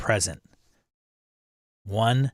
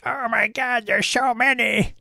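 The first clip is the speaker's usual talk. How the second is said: much higher, squealing mock panic; mock-overwhelmed Harrigan; 14 semitones higher, 4 dB louder than usual. Host teasing a viewer who can't pick one book, mock-overwhelmed high voice. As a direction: imitate someone comically overwhelmed by choice. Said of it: "Harrigan's gonna be like".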